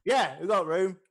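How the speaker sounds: loud, high character reply; orc innkeeper. Acted. Orc barkeep offers the newcomer a room, loudly. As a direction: call it out as a rough barkeep.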